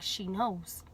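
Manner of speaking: low, soft retold warning; understated, secretive delivery; hushed bad news. Breaking bad news to boyfriend.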